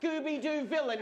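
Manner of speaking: ordinary talk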